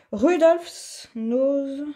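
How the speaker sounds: "Rudolph's Nose" read slowly in careful French-accented English, each syllable drawn out. Real, not acted. Crafter reading a paint label to viewers, slow French-accented English. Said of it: "j'ai quelques souvenirs de mon anglais".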